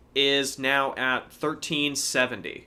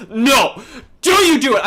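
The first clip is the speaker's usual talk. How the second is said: loud, high-pitched pleading scream; retold scream; 9 semitones higher, 8 dB louder than usual. Storyteller relives begging a queasy eater not to do it, screaming. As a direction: shout it high, begging him to stop. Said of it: "I was screaming because".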